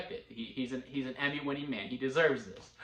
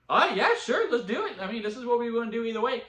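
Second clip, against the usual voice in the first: eager agreement; 8 semitones higher, 4 dB louder, faster than usual. Retold or imitated.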